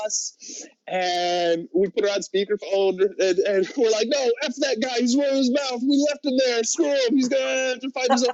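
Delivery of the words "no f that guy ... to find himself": higher, taunting imitation of the guys brushing him off; mock dismissive. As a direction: play the heartless friends. Friends taunting on speakerphone.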